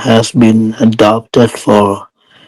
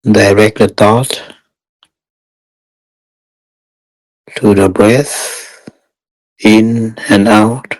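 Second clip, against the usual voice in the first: guided meditation. Real, not acted. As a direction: guide meditators step by step, unhurried and even.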